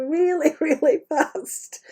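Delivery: ordinary talk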